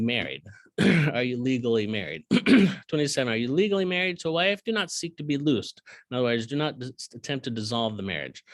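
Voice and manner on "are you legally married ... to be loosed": brisk, slightly raised Bible reading; scripture reading. Acted. Bible teacher reading the verse to his class, lifted.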